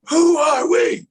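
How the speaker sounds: loud, high shouted chant leader; cheer call. Retold or imitated. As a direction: shout a rallying call to the team. Acting out sideline cheer call.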